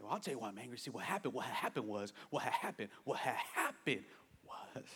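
low, quiet mumbled excuse; mock excuse-making. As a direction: play someone softly explaining themselves away. Acts out an angry person's excuses.

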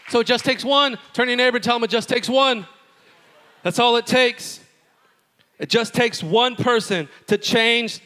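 loud, high preaching at full force. Acted. Pastor urging the congregation, preaching at full force. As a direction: drive it home to the congregation.

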